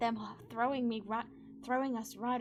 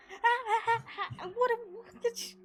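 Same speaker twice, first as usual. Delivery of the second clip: high stammering yelps; spluttering in sudden panic; panicking; 12 semitones higher, 5 dB louder than usual. Acted. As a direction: centaur panicking with flared wings. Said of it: "he starts to panic".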